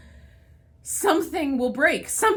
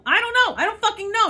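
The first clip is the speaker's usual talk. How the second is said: much higher and louder, know bursting out; frustrated yell; 9 semitones higher, 6 dB louder, faster than usual. Real, not acted. Venting to viewers about job hunting, exasperated yell. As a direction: let it snap into a yell.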